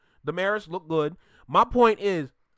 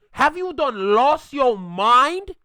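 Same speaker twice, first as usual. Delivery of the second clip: outraged; 4 semitones higher, 6 dB louder, much slower than usual. Real, not acted. Outraged rhetorical question at Rory.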